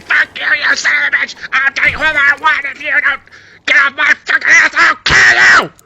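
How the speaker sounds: high, fast, screaming rage; furious rant. Retold or imitated. Dad screaming at his son in a rage-fueled outburst, high and fast. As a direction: explode at him and keep climbing.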